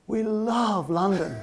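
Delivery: slow, laughing, gushing; gushing delight. Retold or imitated. Wife delighted to meet a Londoner, slow laughing gush. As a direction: swoon over London with a laugh.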